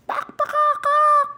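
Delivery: high, loud clucking building to a squawk; imitated chicken. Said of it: "A chicken once told me".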